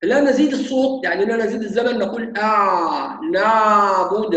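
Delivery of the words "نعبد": نعبد with the ayn dragged out very slowly; overlong ayn. Shown as a demonstration. Teacher demonstrates stretching the ayn too long in نعبد. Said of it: "هذا خطأ".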